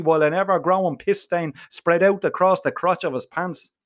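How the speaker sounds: ordinary delivery, nothing marked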